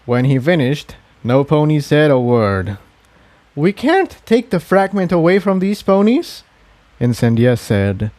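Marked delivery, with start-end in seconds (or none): 3.6-6.4 put-on higher, earnest young mare. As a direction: plead for your people, voice lifted. Incendia pleads for her people.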